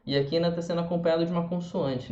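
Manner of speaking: usual speaking voice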